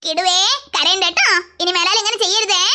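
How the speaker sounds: put-on an indulgent grandma taking the boy's side; grandmother defending. Grandmother consoles.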